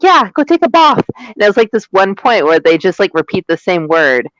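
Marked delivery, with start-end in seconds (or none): 0.0-1.0 mock Marion. Marion voiced in retold scene.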